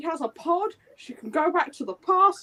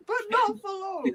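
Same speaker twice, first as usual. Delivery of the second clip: high, ominous narrator tag line; Horrible Histories catchphrase; 8 semitones higher, 4 dB quieter than usual. Shown as a demonstration. Host echoing the Horrible Histories sting, high and ominous. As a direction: tease the twist like a TV narrator. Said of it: "Horrible Histories".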